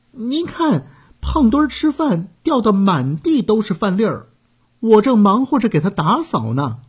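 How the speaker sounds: high, chirpy mouse voice; busy little mouse explaining proudly. A narrator's reading. Mouse answers grandma. (小老鼠的尖细声音)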